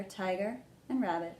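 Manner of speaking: ordinary delivery, nothing marked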